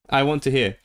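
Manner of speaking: usual speaking voice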